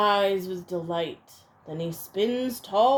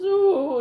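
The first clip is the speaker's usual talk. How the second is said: whimpering moan; 9 semitones higher, 7 dB louder than usual. Acted. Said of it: "he whimpers and moans".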